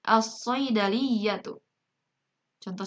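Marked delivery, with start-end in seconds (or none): 0.1-1.6 slow "as-saydaliyatu" with a heavy doubled S; silent lam. Shown as a demonstration. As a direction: read "as-saydaliyatu" deliberately, lam merged into shad. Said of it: "lamnya tidak dibaca jelas".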